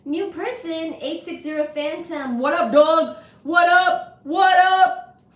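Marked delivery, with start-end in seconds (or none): 2.4-5.2 shouted what up to viewers; loud greeting. Real, not acted. Streamer calling out to her live chat, loud. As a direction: call it out big and loud.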